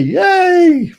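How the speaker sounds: high, drawn-out hooray; playful cheer. Pretend. Streamer rallying viewers to the Yorkshire Party, playful cheer. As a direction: let out a high mock-campaign cheer.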